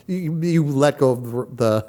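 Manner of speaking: usual speaking voice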